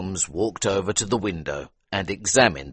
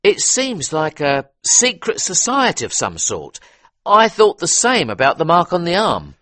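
higher, louder eager police inspector; 11 semitones higher, 6 dB louder than usual. A narrator's reading. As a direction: talk it through like a keen detective. Inspector answers.

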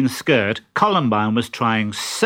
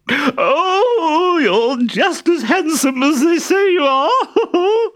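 very high, loud simpering falsetto; disguised Harlequin; 5 dB louder, slower than usual. Acted. Harlequin in a dress flirting with the captain, high simpering falsetto. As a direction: gush like an ugly coy bride.

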